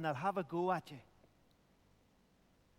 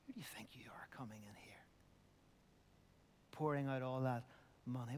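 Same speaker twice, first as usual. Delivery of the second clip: hushed, fast scolding with a whispered start; scolding critics; 7 semitones lower, 9 dB quieter than usual. Retold or imitated. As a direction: hiss the critics' rebuke under your breath. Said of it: "they scolded her".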